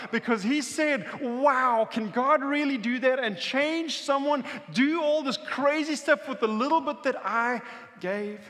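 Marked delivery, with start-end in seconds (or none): 1.2-8.4 loud, high-pitched wonder; awestruck kid. Acted. Kid whose little gift fed everyone, excited wonder. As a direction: imitate an amazed child marvelling.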